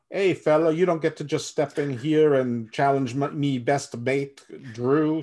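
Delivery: a mock Irish accent, puffed up and loud; bad Irish accent. Cocky wrestler squaring up to a rival, in a hammy Irish accent. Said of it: "that's a horrible accent".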